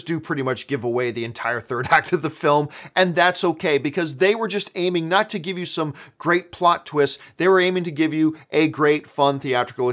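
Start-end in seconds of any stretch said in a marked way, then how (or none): none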